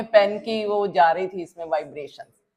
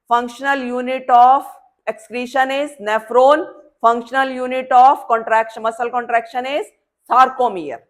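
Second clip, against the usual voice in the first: very slow, louder chant of the definition; slow emphatic dictation; 3 semitones higher, 5 dB louder, much slower than usual. Shown as a demonstration. Teacher drilling functional unit definitions, slow and emphatic. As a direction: drum the definition in slowly. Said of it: "जैसे हम बोलते हैं ना".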